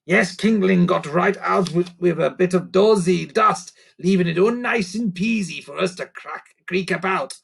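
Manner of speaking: a little creature offering advice; helpful creaker. Acted. Sniff creature voice.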